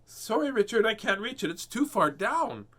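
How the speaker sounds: high, loud storybook mother voice; Missus Rabbit. A narrator's reading. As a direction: call it out apologetically, pitched well up. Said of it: "said Missus Rabbit".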